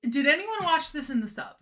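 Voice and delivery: ordinary talk, nothing marked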